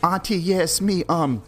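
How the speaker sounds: high, whiny burglar voice to his auntie; fake phone call. Burglar faking a call to his aunt, high whiny pleading.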